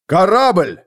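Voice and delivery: громкий высокий крик тревоги; выкрикни, заметив корабль. A narrator's reading. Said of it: "Оберхоф поднял крик". (капитан кричит, заметив корабль, громко)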